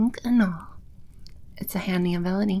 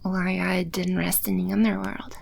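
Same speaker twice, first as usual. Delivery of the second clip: normal talking voice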